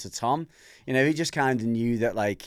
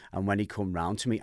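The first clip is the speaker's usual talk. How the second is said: ordinary talk, nothing marked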